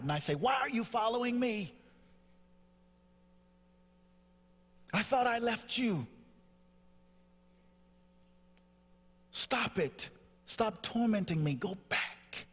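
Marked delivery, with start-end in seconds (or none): 0.3-11.5 high-pitched retold tirade, totally spinning out. Son spins out at the father following him, tirade. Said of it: "I have this tirade, just a, a total spin out". 11.5-12.5 high-pitched retold spin-out; tirade. Son orders his father's voice away in the restroom, tirade. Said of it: "I have this tirade, just a, a total spin out".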